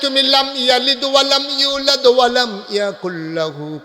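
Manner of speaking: high, loud, ringing sermon cry; preaching at full force. Acted. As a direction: shake the night with the call. (উঁচু গলায় জোরালো ওয়াজ)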